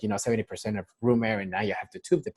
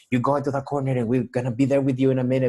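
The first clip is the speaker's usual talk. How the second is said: raised, pressed voice, running through excuses; louder and higher, juggling too much at once; harried hospital staff; 4 semitones higher, 5 dB louder than usual. Retold or imitated. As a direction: imitate an overloaded nurse putting someone off. Acted hurried staff putting patient off.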